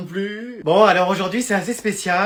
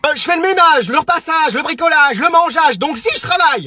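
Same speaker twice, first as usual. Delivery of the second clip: indignant mother; 7 semitones higher than usual. Acted. Mother lists chores indignantly.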